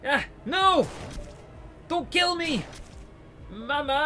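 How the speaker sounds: high, shouted pleas for mercy; a prisoner screaming as he's shot at; terrified screams. Acted. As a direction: scream for your mother.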